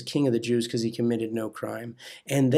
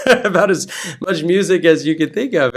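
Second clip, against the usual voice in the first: laughing at his own piracy, a bit louder; laughing through it; 4 semitones higher, 7 dB louder than usual. Real, not acted. Admitting music piracy to the evangelist, laughing. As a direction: chuckle out the confession.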